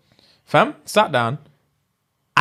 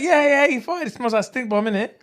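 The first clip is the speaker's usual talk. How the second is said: tag; 8 semitones higher than usual. Retold or imitated. Friend gleefully tells on him, retold.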